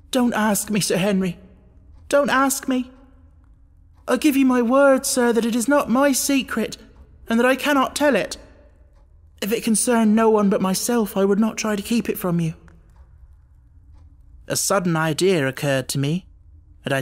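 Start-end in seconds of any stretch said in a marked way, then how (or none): 0.1-1.4 higher, begging not to answer; pleading. A narrator's reading. Butler begs master. 2.1-12.5 much higher, louder, near breaking; desperate pleading. A narrator's reading. Butler desperate, refuses secret.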